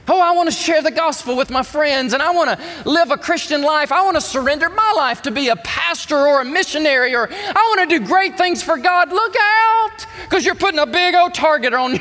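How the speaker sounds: high and loud, urgent; impassioned preaching. Real, not acted. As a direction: paint the believer's hopes, then warn. Voices believer then warns.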